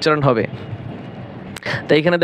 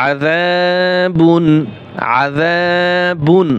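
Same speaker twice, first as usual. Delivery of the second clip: very slow, each syllable of আযাবুন held; slow drawn recitation; much slower than usual. Shown as a demonstration. Teacher modelling the word for students, drawn out. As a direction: stretch আযাবুন out like tarteel. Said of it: "এখানেও এক আলিফ টান হবে".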